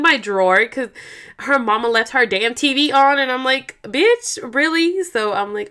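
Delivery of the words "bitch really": high-pitched incredulous complaint; mock exasperation. Aunt annoyed the TV was left on, incredulous. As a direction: voice jumps up in disbelief. Acting out annoyance at niece's mother.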